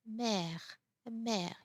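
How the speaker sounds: mère with a wide open "è"; open accent grave. Shown as a demonstration. French teacher showing accent grave, mère with open è. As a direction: open the mouth on the vowel of mère, like "bed". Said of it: "open the mouth a little bit more".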